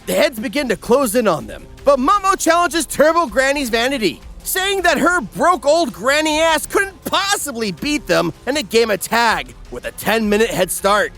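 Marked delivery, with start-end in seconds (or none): none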